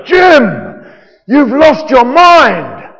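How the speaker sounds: loud, high, dragged-out cry of disbelief; McCoy's exasperated outburst. Acted. Ship's doctor protesting to his captain, in a hammed-up shout. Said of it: "And McCoy would be going".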